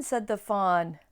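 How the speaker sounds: ordinary delivery, nothing marked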